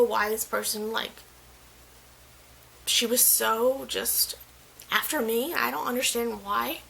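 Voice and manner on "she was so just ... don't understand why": ordinary delivery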